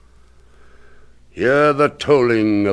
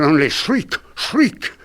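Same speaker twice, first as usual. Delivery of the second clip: shrieking; 6 semitones higher, 4 dB louder than usual. A narrator's reading. Shrieking bells, high jump.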